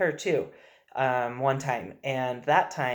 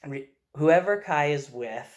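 ordinary talk, nothing marked

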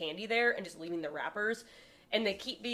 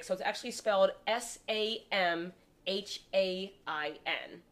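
usual speaking voice

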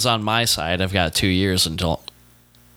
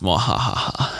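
evil laugh; slower than usual. Pretend. Spooky outro, evil laugh.

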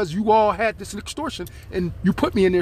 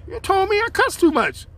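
much higher, teasing scold; scolding mom playfully; 13 semitones higher than usual. Retold or imitated. Son teasing his mother for cussing, high playful scold. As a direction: wag a finger at mom with a grin. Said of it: "I said".

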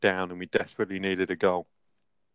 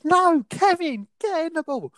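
much higher, slow mocking coach's order; mock coach shout. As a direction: parody the stubborn coach overruling the player. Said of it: "He goes".

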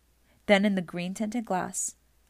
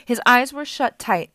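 ordinary delivery

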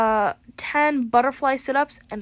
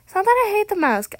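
ordinary delivery

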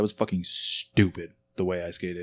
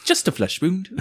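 mock knight voice; 11 semitones higher than usual. Monty Python quote, high far voice.

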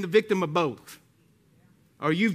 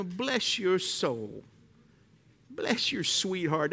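slow, sweet mock-polite drawl; sugary Southern blessing; 3 semitones lower, 5 dB quieter, much slower than usual. Pastor mocking a sweet Southern put-down, slow and syrupy. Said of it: "a Southern term that we use".

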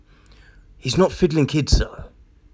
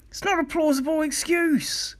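much higher, softer take; high mocking repeat; 13 semitones higher, 4 dB quieter than usual. Real, not acted. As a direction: lift it into a sneering high pitch.

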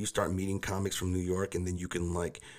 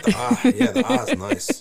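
laughing; 9 dB louder than usual. Real, not acted. Laughing over the exhale.

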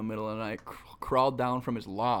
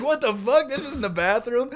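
high, raised voice of disbelief; shocked realization; 12 semitones higher, 5 dB louder than usual. Retold or imitated. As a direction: act out waking up bewildered and aghast. Acts out his shocked realization.

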